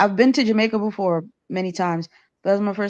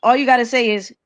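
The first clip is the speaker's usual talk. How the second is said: ordinary delivery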